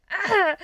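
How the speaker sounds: high, loud yelp of pain. A narrator's reading. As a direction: cry out as your wrist bends. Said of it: "making Peter yelp in pain".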